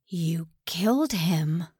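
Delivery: slow, breathy tear-choked whisper. Acted. Soon accusing the general, whispering through tears. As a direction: force it out past the tears.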